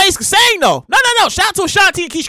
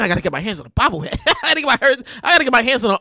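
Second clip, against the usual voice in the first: normal talking voice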